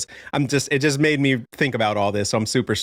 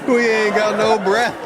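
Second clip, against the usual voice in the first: high, loud laughing quip; laughing parody; 9 semitones higher, 4 dB louder than usual. Acted. Host joking about the song while cracking up, high and loud.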